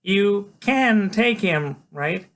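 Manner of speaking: put-on high, slow, loud kid's question; high-pitched kid seeking agreement; Freak. A narrator's reading. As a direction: ask in a high kid voice, drawn out. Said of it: "he asks a couple minutes later".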